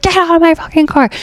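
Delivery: high, fast imitation of an irate friend; mock screaming. Friend ordering the stranger out of his car, mock screaming. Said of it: "He's, like, screaming".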